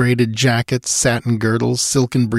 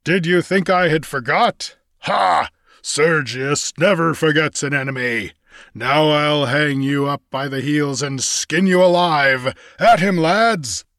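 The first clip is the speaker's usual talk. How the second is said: high, hateful giant pirate voice; gloating giant with a mocking laugh; giant alien; 6 semitones higher than usual. A narrator's reading.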